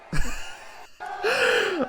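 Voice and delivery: high burst of laughter; real laugh. Real, not acted. Streamer laughing at Undertaker sprinting off, high burst of laughter. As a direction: crack up at the clip.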